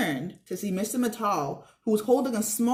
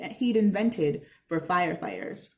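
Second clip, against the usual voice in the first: ordinary talk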